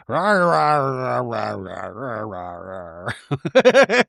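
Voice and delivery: low, growly nonsense chatter sinking away; mock bickering noises. Mimicking the two just talking, mock grumble.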